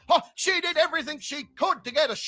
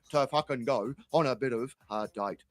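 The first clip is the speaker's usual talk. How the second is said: imitating Terry; 11 semitones lower than usual. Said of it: "He goes".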